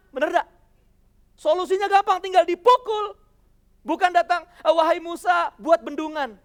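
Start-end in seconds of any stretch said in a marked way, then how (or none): 4.6-6.4 mock call out. Mock absurd divine order.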